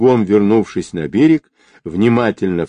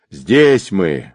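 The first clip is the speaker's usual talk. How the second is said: usual speaking voice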